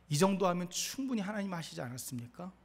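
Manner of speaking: normal talking voice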